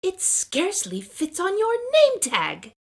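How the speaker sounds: mock her with a smug high voice; teasing girl. Classmate teasing her about the name tag, high and smug.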